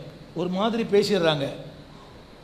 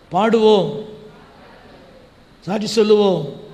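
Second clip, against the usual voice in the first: higher-pitched call-out to a congregation; mock congregational call; 3 semitones higher, 3 dB louder than usual. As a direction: call it out like a service leader. Imitating calls to the congregation.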